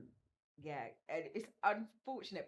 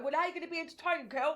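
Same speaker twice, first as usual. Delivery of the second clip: lightly mimicked castmate, quick and higher-pitched; quick, raised-pitch quoting of his question; imitating Andy; 7 semitones higher, 4 dB louder than usual. Retelling his reply to an argument, playful imitation. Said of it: "That's how he talks".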